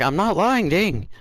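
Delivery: high-pitched, wavering protest; acted protest. Accused student answering the screaming teacher, high-pitched protest.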